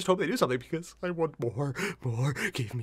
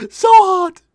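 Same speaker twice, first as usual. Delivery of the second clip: squealing fangirl; 15 dB louder than usual. Retold or imitated. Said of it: "It's like".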